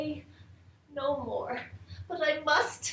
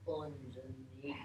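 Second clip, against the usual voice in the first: breathy whispered question; barely voiced, secretive; 12 semitones lower, 22 dB quieter than usual.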